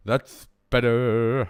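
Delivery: mic test. Shown as a demonstration. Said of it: "Now that the mic's back at my face".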